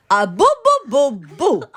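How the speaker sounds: put-on high, very loud baby boos; scolding baby. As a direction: belt out each boo as a baby's order. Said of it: "And the baby says".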